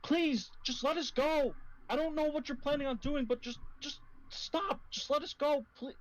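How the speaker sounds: high, pleading woman's voice; desperate high-pitched plea; woman crying out. Acted. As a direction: beg urgently in a high female voice. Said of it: "a woman's voice cries out".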